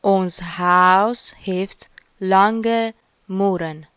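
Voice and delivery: slow, loud teacher's model; very slow dictation. Shown as a demonstration. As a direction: dictate Ons haus heeft lange muren very slowly. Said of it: "سومین جمله میگه".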